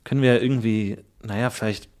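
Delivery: coy low flirting; low Milbea voice. Acted.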